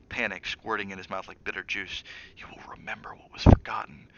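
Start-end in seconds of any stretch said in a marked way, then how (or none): 2.4-4.1 breathy ghostly whisper; a hissing whisper from nowhere; eerie whisper. A narrator's reading. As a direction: whisper it like a voice inside his head. Haunting voice in Danny's mind.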